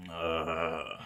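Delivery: normal talking voice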